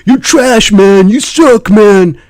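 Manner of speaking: high loud sneering taunt; toxic trash talk. Retold or imitated. Toxic player imitated, high loud sneer. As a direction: spit out the insult like a troll. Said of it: "This is what they're gonna hear".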